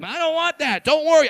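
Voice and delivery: ordinary talk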